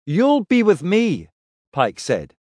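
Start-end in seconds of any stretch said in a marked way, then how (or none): 0.0-1.3 higher, slower reassuring captain; Pike's voice. Acted. Pike reassures Kref.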